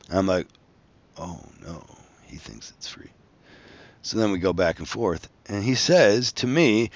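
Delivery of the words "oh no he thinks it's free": much softer, lower muttered dread; dismayed aside. Retold or imitated. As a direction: drop to a low, dismayed inner voice. Inner thought on realizing misunderstanding.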